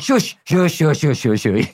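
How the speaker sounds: high, hurried shushing; hushing. Retold or imitated. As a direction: shush the voice away fast. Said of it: "And I went".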